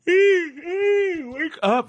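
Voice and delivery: high, loud call to wake up. Real, not acted. Rousing herself mid-rant with a loud call. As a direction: snap herself awake with a loud cry.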